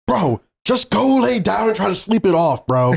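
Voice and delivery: high-pitched exasperated yell, no real volume; retold yelling. Scolding the friend who knocked, mock high-pitched yelling. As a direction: re-enact yelling at him, voice jumping up high. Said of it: "I was, like, yelling at him".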